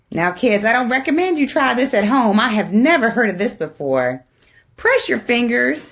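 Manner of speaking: ordinary delivery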